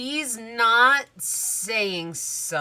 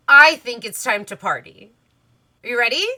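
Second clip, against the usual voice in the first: loud, raised party call; rousing announcement; 5 semitones higher, 13 dB louder, faster than usual. Pretend. Cinderella looks at you all, loudly announcing party time. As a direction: pretend to rally the whole group.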